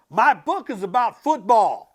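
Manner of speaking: put-on a boy roaring with excitement. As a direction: bellow it like a proud boy. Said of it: "Matt roared".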